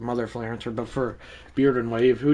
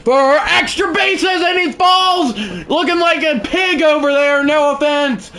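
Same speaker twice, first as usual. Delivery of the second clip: much higher, louder broadcast call; play-by-play call; 13 semitones higher, 6 dB louder than usual. Acted. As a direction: shout it like a live sports announcer. Calls his own at-bat.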